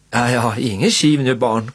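ordinary delivery, nothing marked

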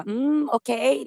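slow, drawn-out doubtful mm okej; a skeptical hum, not buying it; mock doubtful okay; unconvinced. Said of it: "lite så här".

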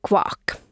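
whispered duck quack; a soft breathy kvak like a duck; voiceless little duck sound. Acted. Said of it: "sorsia, jotka sanoo".

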